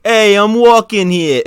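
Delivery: loud, high New York street shout; brash New York "I'm walking here" yell; New York accent. Retold or imitated. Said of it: "[name] had to do the New York accent for the quote".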